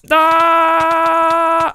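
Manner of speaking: high, loud battle yell. Real, not acted. Gamer screaming at an enemy he is attacking, a loud yell.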